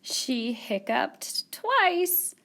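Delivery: very slow, drawn-out build; slow dramatic reveal. Acted. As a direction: stretch it out for suspense. Story punchline, long pauses.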